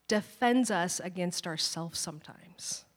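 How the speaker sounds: soft, slow whisper; whispered aside. Real, not acted. Preacher to congregation, hushed whisper on the key point. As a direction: lean in and let it drop to a whisper.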